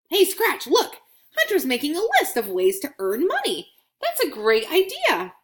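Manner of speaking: a coin cheering a good plan; delighted coin. Acted. Coin voiced in children's story.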